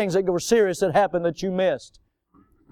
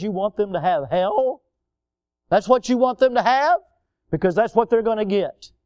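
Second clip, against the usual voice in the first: ordinary talk, nothing marked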